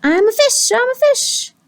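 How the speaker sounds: high, playful little cheer; gleeful pigeon. Acted. Pigeon pretending in the tub, high gleeful play. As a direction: squeal it like a playing child.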